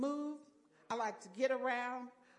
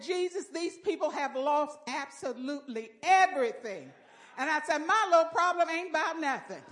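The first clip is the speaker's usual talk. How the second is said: loud, fervent testimony shouts; a full-voiced cry of repentance and praise; preaching at full force; 3 semitones higher, 9 dB louder than usual. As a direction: lift it up like a church shout.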